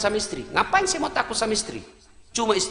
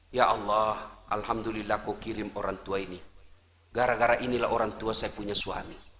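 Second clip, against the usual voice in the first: soft, low thankful prayer; grateful prayer; 14 semitones lower, 9 dB quieter than usual. Pretend. Wife thanking God for her in-laws, soft and grateful. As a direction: pray gratefully and quietly. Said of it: "Ibu sujud syukur".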